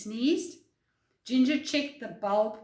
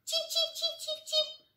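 very high, tiny peeps; cheeping baby birds. Acted. Storyteller voicing a nestful of baby robins, high cheeping. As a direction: peep like chicks in a nest.

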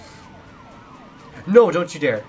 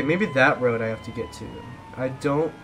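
normal talking voice

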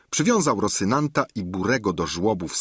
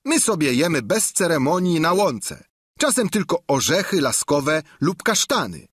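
higher, louder squire's voice; a plain-spoken squire, higher and louder; Sancho's voice; 8 semitones higher, 3 dB louder than usual. Acted. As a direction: Sancho voiced high and loud. Sancho defending his master.

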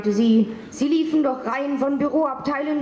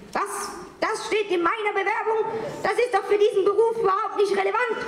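high, rattled disbelief slowing at the end; flustered surprise; 7 semitones higher than usual. Acted. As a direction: voice jumping up high, tumbling out fast. Secretary flustered by her file.

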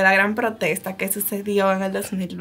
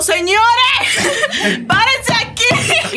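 laughing warning; 10 semitones higher, much slower than usual. Retold or imitated. Warning everyone about the motorbike.

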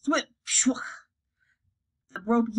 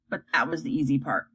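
ordinary delivery, nothing marked

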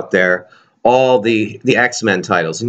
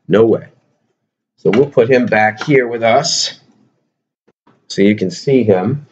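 ordinary delivery

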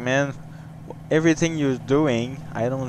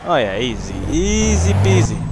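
singsong gloat; 3 semitones higher, 3 dB louder than usual. Real, not acted. Gloating after passing the test.

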